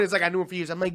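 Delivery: usual speaking voice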